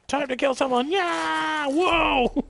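high, loud charging yell; excited battle cry. Real, not acted. Player going in to kill someone, excited yelling. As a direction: whoop as you rush in.

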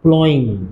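"प्लॉइंग" with silent gh; correct pronunciation. Shown as a demonstration. Teacher giving students the word for ploughing, correct pronunciation. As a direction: say "प्लॉइंग" with no g or f in the middle. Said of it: "इसका तलफ़ुज़ ये है".

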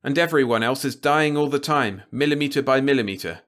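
a reporter's impassioned, driving appeal; passionate plea. A narrator's reading. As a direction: hammer the moral stakes home. Radek, pleading passionately.

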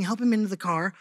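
ordinary talk, nothing marked

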